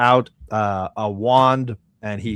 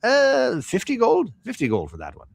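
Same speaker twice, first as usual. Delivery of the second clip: high, questioning price quote dropping back down; haggling shopkeeper; 4 dB louder than usual. Acted. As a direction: float a price like an unsure merchant.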